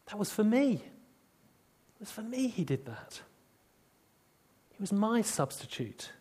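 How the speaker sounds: quiet, awestruck confession; awed realization. Retold or imitated. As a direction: say it softly as it dawns on you. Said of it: "That's what these people say".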